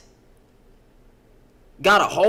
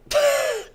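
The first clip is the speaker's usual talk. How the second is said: sudden high laugh in disbelief; a short laugh breaks out; high burst of laughter. Real, not acted. Laughs at the chat.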